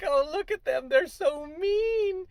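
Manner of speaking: high, loud, slow fake sob; hammed-up crybaby critic; mock crying whine. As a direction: wail it like a sulky child.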